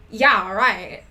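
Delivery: loud sarcastic exclamation; exclaimed. Acted. Willow teasing Raven about Draco, loud exclamation. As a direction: throw it back loudly, not buying it. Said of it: "she exclaimed".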